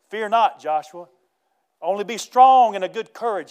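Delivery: loud, rising charge with punched key words; rally Joshua with preaching force; forceful exhortation. Retold or imitated. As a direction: pulpit cry of encouragement. Preacher delivers God's charge to Joshua.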